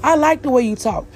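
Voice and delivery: high-pitched gushing mock questioner; mocking demeaning onlooker. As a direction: mimic someone treating an accent as a novelty. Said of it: "say something or do something that's very demeaning".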